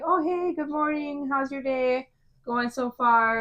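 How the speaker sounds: high, slow, sugary-sweet morning hello; fake cheerful greeting. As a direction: greet them like everyone's best friend, drawn out. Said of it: "super happy".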